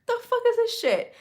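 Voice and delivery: high, disbelieving swear; squeaky what-the-hell reaction; shocked outburst. Retold or imitated. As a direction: blurt it out in stunned disbelief. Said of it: "And I'm like".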